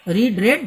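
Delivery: রিড with a long ee, then রেড with a short eh; রিড then রেড, reed then red; read, present then past. Shown as a demonstration. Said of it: "উচ্চারণ মানে প্রোনাউন্সিয়েশনটা change হয়ে যায়".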